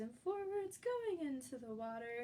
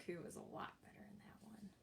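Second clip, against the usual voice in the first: whisper; 15 dB quieter, faster than usual. Real, not acted. Aside about burping contest.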